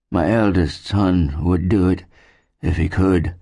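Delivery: slow, worn invalid character voice; ailing settler. A narrator's reading. Sick settler answers a request for help, slow and ailing. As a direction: answer slowly, as a sick man.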